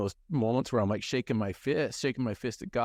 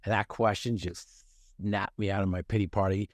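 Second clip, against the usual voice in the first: ordinary talk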